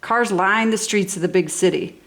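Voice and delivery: ordinary delivery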